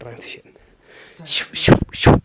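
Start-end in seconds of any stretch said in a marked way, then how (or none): 1.3-2.1 breathy whoosh noises for a video cut; whoosh transition sound. Pretend. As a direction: blow a swishing transition effect with the mouth. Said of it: "before transition എടുക്കട്ടെ".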